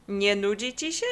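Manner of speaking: lightly mocking question; a touch of a jeer; teasing mockery. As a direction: poke fun at a lazy friend. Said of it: "zakpił Kubuś".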